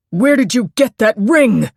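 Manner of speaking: loud, high furious shout; an angry man shouting at a terrified woman; shouted again. A narrator's reading. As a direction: yell the demand at her. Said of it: "he shouted again".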